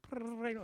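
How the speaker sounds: high rolled buzzer noise; buzzer imitation. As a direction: trill like a doorbell buzzer. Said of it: "I could hear the buzzer going".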